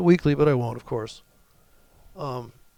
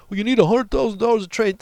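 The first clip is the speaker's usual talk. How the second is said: snide mock-pompous voice, louder; mocking the Power Trading guys; 7 semitones higher, 6 dB louder, faster than usual. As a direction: parody a know-it-all trader. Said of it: "these assholes over in Power Trading".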